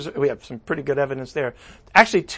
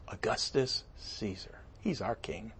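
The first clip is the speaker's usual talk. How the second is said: whispered crowd; 6 semitones lower, 17 dB quieter than usual. Acted. Whispered crowd reply, ironic aside.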